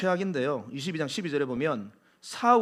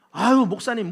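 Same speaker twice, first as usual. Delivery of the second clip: louder, scoffing sigh at the pastor; dismissive sigh; 4 semitones higher, 6 dB louder than usual. Retold or imitated. A displeased member scoffing at the pastor. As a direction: brush the pastor off. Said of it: "무시하고 함부로".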